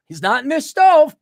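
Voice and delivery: high, loud nervous lie; guilty fib. Pretend. Guilty friend lying to the cop, high loud blurt. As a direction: blurt the lie like a bad liar.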